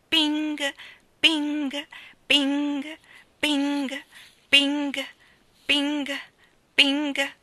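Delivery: high, evenly spaced ringing pings; clock chime imitation. A narrator's reading. Narrator imitating the square's clock, high chiming pings. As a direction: ring out each ping like a striking clock.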